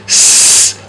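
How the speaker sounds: "S" as a long unvoiced hiss, sss; snake-like s. Shown as a demonstration. Teacher showing basic S sound, "S" hissed like a snake. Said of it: "like a snake".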